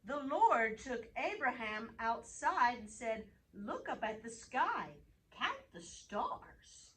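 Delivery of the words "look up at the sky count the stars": usual speaking voice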